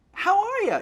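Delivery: very high, loud showbiz greeting. Retold or imitated. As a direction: gush like an effusive diva. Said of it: "my [name] impersonation".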